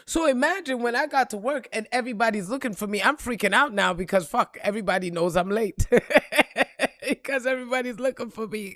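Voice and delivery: ordinary talk, nothing marked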